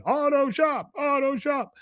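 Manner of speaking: high-pitched imitation of yelling kids; mock kids' screaming. Kids horsing around at a club fair, mock screaming. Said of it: "kids horse playing, screaming".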